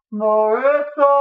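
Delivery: slow, stretched, loud high wail; drawn-out panicked shout. Acted. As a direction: drag her name out desperately. Frantic calls at door.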